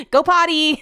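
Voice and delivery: high, loud puppy-coaxing call; teasing pet cue. Acted. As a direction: tease with a squeaky loud dog command. Teasingly repeats the forbidden phrase.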